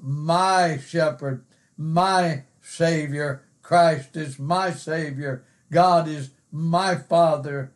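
every "my" drawn out slowly; slow, weighty my before each noun; emphasis on MY. Shown as a demonstration. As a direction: stretch each my and set it apart. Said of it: "put some emphasis on that personal pronoun".

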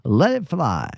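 hammed-up excited old deacon. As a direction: shout it back like a deacon caught up in the sermon. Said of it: "getting excited with him".

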